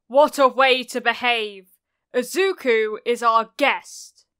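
loud telling-off; angry scolding. A narrator's reading. Auntie scolds Kacchan over their guest, angry and loud. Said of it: "Auntie scolded, sounding angry".

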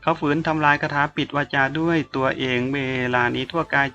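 ordinary delivery

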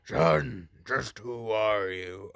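lightly voiced dark knight; a hint of a brooding knight. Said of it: "the Dark Knight voice".

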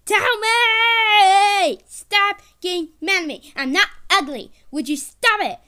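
loud, high, exasperated shouting; angry yelling. Acted. Kid in baby's body argues with mom, angry yelling.

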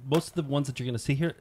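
usual speaking voice

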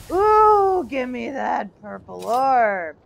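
slow, eager demand; greedy drawn-out call. Real, not acted. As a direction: stretch it out hungrily. Gamer eagerly calls for an item.

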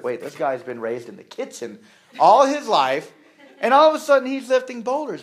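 disbelieving, rising to a laugh; can't believe what he's seeing, laughing at the end; incredulous. Retold or imitated. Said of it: "And you're like".

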